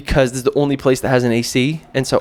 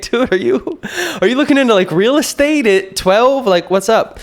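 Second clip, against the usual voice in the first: amused disbelief; 8 semitones higher than usual. Retold or imitated. Amused reply to boy's question.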